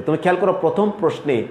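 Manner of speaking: normal talking voice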